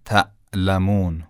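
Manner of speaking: "تعلمون" broken by a glottal stop after the first syllable; hamza stop. Shown as a demonstration. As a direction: stop the sound dead after تَ in "تعلمون", then resume.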